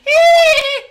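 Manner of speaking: high, loud squeal of delight; shrill delighted shriek; mock squeal. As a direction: squeal it out like a giddy girl.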